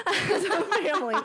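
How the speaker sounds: high, laughing aside. Real, not acted. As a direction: say it through a giggle. Laughs mid-reading.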